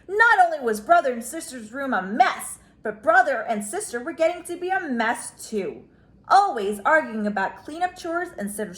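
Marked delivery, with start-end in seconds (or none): none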